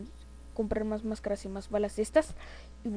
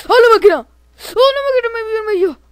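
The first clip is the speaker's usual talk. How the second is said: high, loud cry of alarm; play-acted panic, shouted high; panicked shout; 12 semitones higher, 15 dB louder than usual. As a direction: yell out as if spotted by a monster. Panics at machine spotting him.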